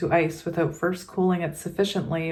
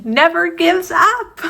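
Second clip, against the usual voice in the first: never gives up, high, loud and slow; playful high cheer on never gives up; cheering squeal; 10 semitones higher, 6 dB louder than usual. Shown as a demonstration. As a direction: belt the words out like a proud cheer.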